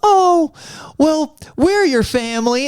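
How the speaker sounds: high, mock-sympathetic cooing; imitated TV judge. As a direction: coo it high, as if consoling. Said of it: "And she goes".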